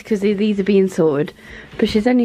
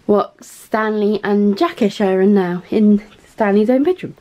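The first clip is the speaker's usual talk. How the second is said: ordinary delivery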